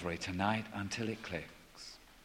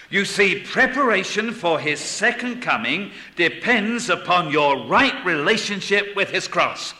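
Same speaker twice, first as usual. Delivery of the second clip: loud, raised pulpit delivery; forceful preaching; 7 semitones higher, 8 dB louder than usual. Real, not acted. Preacher explaining readiness for the second coming, preaching forcefully. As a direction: drive each point home to the congregation.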